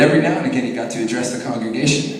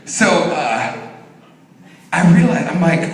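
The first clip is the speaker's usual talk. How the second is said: normal talking voice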